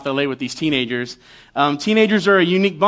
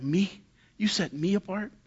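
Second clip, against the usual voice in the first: hushed, much softer, moved; almost breathe it, touched and grateful; awed Jeremiah; 10 dB quieter than usual. Acted. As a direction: Jeremiah melting at God's tender words, hushed. Jeremiah moved by God's words.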